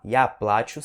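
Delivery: плачу as PLA-chu, first syllable strong; stress on the A. Shown as a demonstration. Teacher repeats the first case for "I cry", stressed A in плачу. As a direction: hit the A in плачу. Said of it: "sílaba tônica no A".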